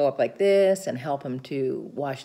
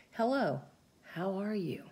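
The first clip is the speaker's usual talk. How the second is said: soft, hushed greeting fading quieter; gentle hello; 3 semitones higher, 7 dB quieter than usual. Retold or imitated. Caregiver patiently greeting a wary patient, soft and gentle. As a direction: coax trust with a soft hello. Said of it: "If you speak slow and clearly".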